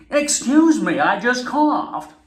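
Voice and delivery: high, slow, loud overexcited delivery; Jane Fonda full energy. Retold or imitated. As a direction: announce a cough like a triumph. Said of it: "just calm down, Jane Fonda".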